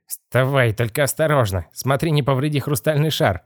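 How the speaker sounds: anxious warning. Acted. Boss worried about the crystal ball, fussy warning. Boss orders boy to be careful.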